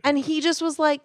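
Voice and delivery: ordinary talk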